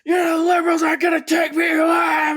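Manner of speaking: high, loud defiant yell; ranting shout. Retold or imitated. Imitating a pundit ripping his shirt, high loud yell. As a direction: roar it like you're tearing your shirt. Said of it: "He's like".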